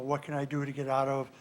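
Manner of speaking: ordinary talk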